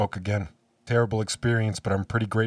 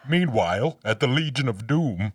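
raised, projected announcer voice; cartoon narrator; 4 semitones higher, 4 dB louder than usual. Cutting to the criminal mastermind's lair, cartoon narrator voice. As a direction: announce the villains' lair with mock drama.

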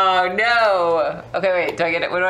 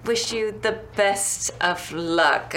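ordinary talk

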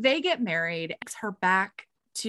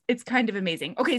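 usual speaking voice

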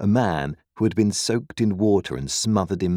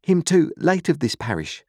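put-on nervous boy; 10 semitones higher than usual. A narrator's reading. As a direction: answer the convict anxiously. Boy answers convict.

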